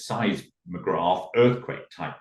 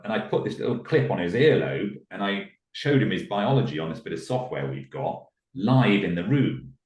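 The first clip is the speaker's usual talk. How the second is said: ordinary talk, nothing marked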